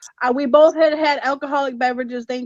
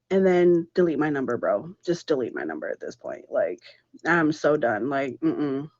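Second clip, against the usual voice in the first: much lower, quieter, done with it; fed-up dismissal; 10 semitones lower, 6 dB quieter than usual. Retold or imitated. To her date the next morning, low fed-up dismissal. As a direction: drop the voice low and flat-out over it.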